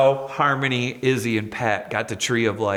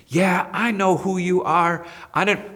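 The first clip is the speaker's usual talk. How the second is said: retold angry rant; 6 semitones higher than usual. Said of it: "was totally cussing me".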